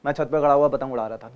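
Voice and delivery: fast, swallowed, careless reading; wrong rushed take. Shown as a demonstration. Teacher showing students the wrong, rushed reading. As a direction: rattle it off sloppily as the wrong way. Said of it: "नहीं".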